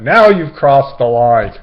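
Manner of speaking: loud, slow mock indignation; mock-offended loud warning; indignant. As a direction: drag out each word like a scolding. Indignant line, story build-up.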